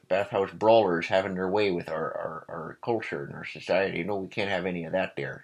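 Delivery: a light mock upper Midwest accent, fast at the end. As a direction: play a scandalized upper Midwest local, lightly. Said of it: "like an upper Midwest accent".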